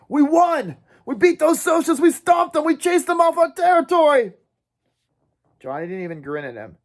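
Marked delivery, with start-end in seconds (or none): 0.1-4.3 forced excitement. Acted. Dally cheering the dying Johnny.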